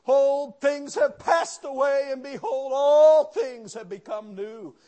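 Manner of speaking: slow, loud preaching cadence. Acted. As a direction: proclaim the verse to the congregation. Preaching scripture at full force.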